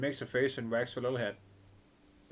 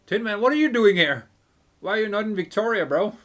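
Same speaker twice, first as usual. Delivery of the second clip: higher, loud and quick questions; loud surprised greeting; 9 semitones higher, 12 dB louder than usual. Acted. Mercenary hailing Tin Man in surprise, loud fast questions. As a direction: shout your delight at a familiar face.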